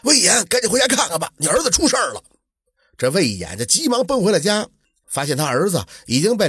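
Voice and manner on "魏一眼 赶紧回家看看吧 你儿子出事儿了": high-pitched, urgent cry of alarm; agitated neighbour raising the alarm; frantic calling out. A narrator's reading. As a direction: call out bad news in a panic, voice pitched up. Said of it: "急赤白脸的嚷着".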